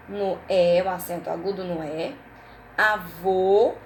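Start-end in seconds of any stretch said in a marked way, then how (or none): 2.8-3.7 Avô with a closed, rounded final O; Avô ending on a drawn-out closed O; closed final vowel. Shown as a demonstration. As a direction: say Avô loudly, closing the last vowel. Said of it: "porque o som é fechado".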